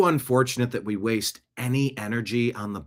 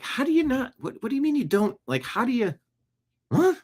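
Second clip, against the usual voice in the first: high-pitched baffled sputter; can't-believe-it stuttering; incredulous; 13 semitones higher than usual. Acted. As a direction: stammer in mock disbelief, voice way up. Acting out baffled reaction.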